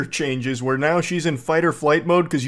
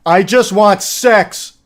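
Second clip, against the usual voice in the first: loud, high, drawn-out mock shout; shouted blurt; 6 semitones higher, 8 dB louder, slower than usual. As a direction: blurt it out slowly at full volume. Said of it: "You don't have to just shout it".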